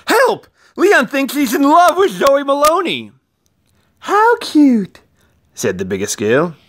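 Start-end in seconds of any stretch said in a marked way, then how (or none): 0.1-3.1 cry for help. A narrator's reading. Valentine cries for help. 4.0-5.0 high, loud gushing coo; cooing girl. A narrator's reading. Biggest girl gushing over Leon's crush, high coo.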